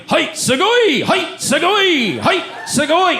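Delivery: high-pitched, drawn-out Japanese cries; mock Japanese actor exclaiming over and over; kabuki porn performer. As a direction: squeal it out in a high put-on voice. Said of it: "He kept saying".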